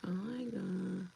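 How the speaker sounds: slow, soft fed-up sigh of words; exasperated murmur. Retold or imitated. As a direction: breathe it out, worn out. Exasperated at being fired.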